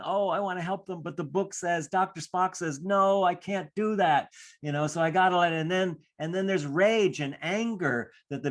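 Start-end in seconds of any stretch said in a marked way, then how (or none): 0.0-4.3 higher, fast, torn parent voice; a worried parent quoting the baby book; mock-fretful mom or dad, pitched up; anxious parent. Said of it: "the parents are probably going".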